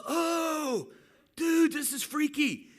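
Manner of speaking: slow, high, loud amazed cry; freaked-out shout. Retold or imitated. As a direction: draw it out, totally spooked. Guy stunned his leg grew.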